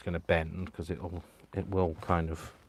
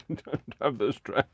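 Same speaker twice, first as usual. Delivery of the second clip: higher, slow, helpless straining; straining with effort; 5 semitones higher than usual. Real, not acted. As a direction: drag it out as strength gives way. Struggling, laughing, with glue bottle.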